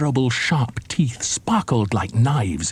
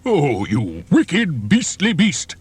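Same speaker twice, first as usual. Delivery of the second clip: slow, higher indignant cry; outraged elephant; 5 semitones higher, much slower than usual. A narrator's reading. Elephant denouncing the crocodile, indignant. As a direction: cry out at the beast. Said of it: "cried Trunky".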